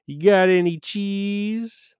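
"You got any cheese" in a high, very slow nasal-free nerd whine; Urkel impression. Streamer quoting a sitcom nerd to chat, high and slow. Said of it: "one of Urkel's, uh, quotes".

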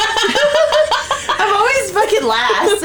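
drawn-out laughing complaint; slow, laughing mock grievance; laughing whine. Real, not acted.

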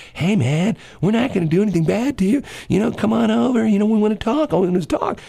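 high-pitched imitation of a placating cop; coaxing cop. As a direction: coax him over as a mock negotiator. Imitating cop coaxing him over.

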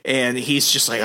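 ordinary talk, nothing marked

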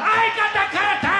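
high, loud comic exclamation; exasperated shout. Real, not acted. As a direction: shout out the punchline.

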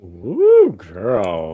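sassy shocked reaction. Real, not acted. Reacting to Texas water news.

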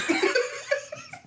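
high giggling monkey hoots; hooting giggly monkey. Real, not acted. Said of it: "I sound like a monkey".

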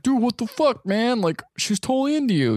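high-pitched, urgent mock protest; exasperated buddy. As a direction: act out a friend in disbelief. Jack's exasperated quote to his friend.

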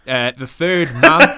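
loud, slow, booming announcer intro. Acted. Podcast host unveils the mystery guest in a booming announcer voice. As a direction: build it up like a ring announcer.

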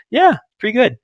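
high-pitched noncommittal imitation; unconvinced reply. She answers his question about sex, high and noncommittal. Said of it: "Like that".

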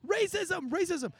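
high-pitched mock crowd chant; retold yelling. Everybody in the stands accusing, imitated yelling. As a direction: repeat it as a mild, high imitation yell. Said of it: "Everybody's just yelling".

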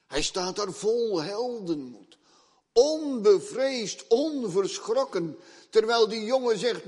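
high, loud, emphatic sermon voice; preacher proclaiming fearless heroism; fiery preaching. Acted. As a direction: exalt the prophet's courage. Preaching at full force.

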